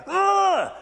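high frustrated "ugh"; exasperated groan. Acted. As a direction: groan like an angry waiting parent. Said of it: "you're getting angry".

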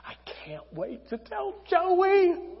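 high, drawn-out excited whisper; whispered excitement. Acted. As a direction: squeal it under your breath. Mary bursting with the news.

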